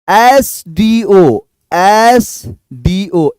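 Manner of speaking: very slow एसडीओ, letters drawn out; slow demo. Shown as a demonstration. Teacher drilling एसडीओ slowly with the class. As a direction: spell एसडीओ slowly for learners. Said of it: "letter demo".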